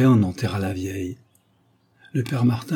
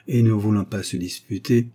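plain reading voice

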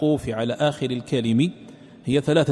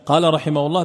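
usual speaking voice

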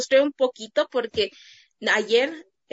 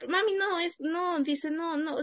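put-on higher, soft little-boy protest; son's voice; 7 semitones higher, 5 dB quieter, slower than usual. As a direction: protest shyly like a small boy.

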